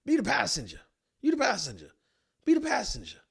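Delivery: high, loud scolding rant; exasperated yelling. Retold or imitated. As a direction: chew him out for failing his one job. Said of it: "And I'm like".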